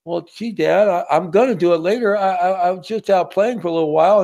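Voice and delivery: put-on high, stammering child excuse; whiny little kid. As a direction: make a boy's nervous excuse. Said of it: "you haven't done your homework".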